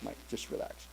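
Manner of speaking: a whispered "Mike, just relax"; soft whisper. Retold or imitated. Nurse soothing him after surgery.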